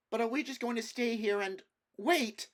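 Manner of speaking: put-on high, loud, breathless girl's voice. Jill to the prince and others, breathless and alarmed. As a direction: gasp it out in alarm. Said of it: "gasped Jill".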